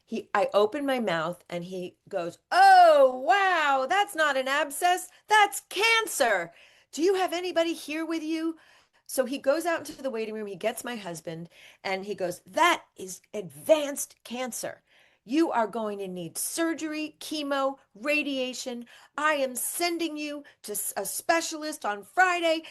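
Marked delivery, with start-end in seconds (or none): 2.5-8.6 high, loud alarmed doctor voice; mimicking a startled ENT doctor, much higher and louder; shocked doctor. 12.5-22.6 a hint of a blunt doctor, higher; mimicking the doctor delivering grim news to the husband, higher. Doctor voiced telling the husband.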